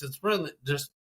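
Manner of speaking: ordinary talk, nothing marked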